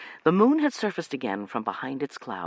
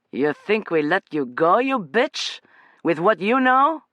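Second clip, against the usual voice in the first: menacing; 7 semitones higher, 5 dB louder, slower than usual. Acted. Captor threatens Amanda.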